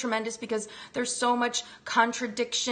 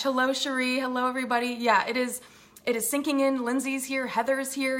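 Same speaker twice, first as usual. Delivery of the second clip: normal talking voice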